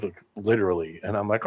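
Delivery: normal talking voice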